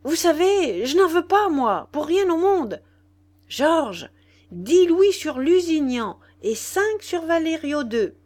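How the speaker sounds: high, loud cry after departing friends; calling out. A narrator's reading. Nana calls after the young men leaving, shouting. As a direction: shout your orders after them as they run off. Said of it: "Nana leur cria".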